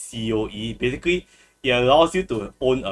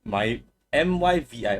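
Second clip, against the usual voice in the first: ordinary delivery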